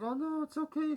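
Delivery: high, falsely sweet brush-off; mock-sweet cover-up after ripping on her; fake nice. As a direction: play it cool in a sugary high voice. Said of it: "She's acting all nice now".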